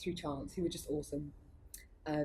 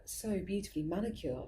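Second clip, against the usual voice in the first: usual speaking voice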